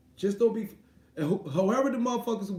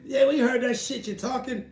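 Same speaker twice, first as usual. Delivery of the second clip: mock fighters' threat; 5 semitones higher, 4 dB louder than usual. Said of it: "Those motherfuckers".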